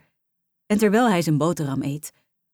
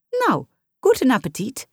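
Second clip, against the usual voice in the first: vrolijke moeder; 7 semitones higher, 3 dB louder than usual. A narrator's reading. Moeder schuift aan met boek.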